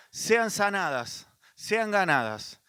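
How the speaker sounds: drawn-out, slow weighty words; solemn slowed preaching; slow emphasis. Real, not acted. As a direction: lean on each word slowly.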